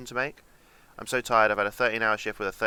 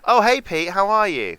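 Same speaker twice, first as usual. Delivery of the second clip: much higher and louder cheery hello; hammed-up greeting; 11 semitones higher, 10 dB louder than usual. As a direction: greet him like a game show host. Exaggerated greeting to viewer.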